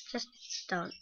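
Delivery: soft whisper. Real, not acted. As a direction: whisper it gently. Whispering to the dog.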